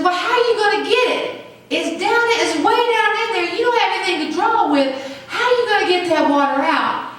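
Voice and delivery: mimicking the woman. Preacher imitating the woman asking how he'll draw water. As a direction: play the doubtful woman, high and fast. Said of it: "but she says".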